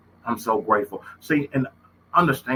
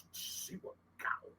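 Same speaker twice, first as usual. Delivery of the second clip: whisper; 18 dB quieter, slower than usual. Real, not acted. Despair after losing child.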